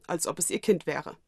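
ordinary delivery, nothing marked

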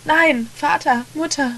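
put-on a small boy crying out over his parents; anguished cries. A narrator's reading. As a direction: cry out for your dead parents. Child finds dead parents.

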